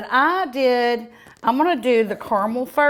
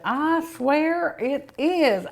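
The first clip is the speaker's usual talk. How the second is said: drawn-out, higher, earnest; slow emphatic swear; 6 semitones higher, much slower than usual. Real, not acted. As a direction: stretch it out, insisting. Repeated praise, then stretched.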